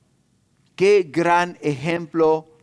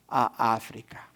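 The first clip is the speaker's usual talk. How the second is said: hushed reveal; 8 semitones lower, 7 dB quieter, slower than usual. Retold or imitated. Punchline of the unexpected posting.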